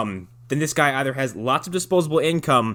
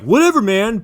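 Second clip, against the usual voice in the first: mock cocky shrug; 8 semitones higher, 7 dB louder than usual. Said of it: "He's like".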